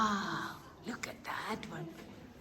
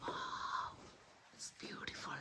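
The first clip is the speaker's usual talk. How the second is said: hushed, awed whisper; 11 dB quieter than usual. Real, not acted. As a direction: whisper it, amazed but quiet. Hushed marvelling near resting bats.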